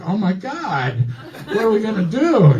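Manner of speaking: high-pitched comic panic; mock horrified. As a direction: play the horrified assistant, voice jumping up. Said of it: "he's, like, horrified".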